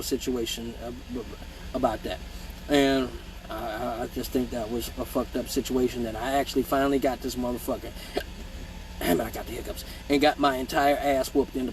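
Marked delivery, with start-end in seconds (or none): none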